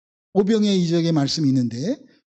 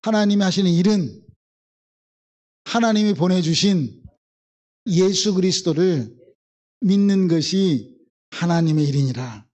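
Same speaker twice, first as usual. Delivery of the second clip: recitation for repeating. Shown as a demonstration. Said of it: "따라서 합니다".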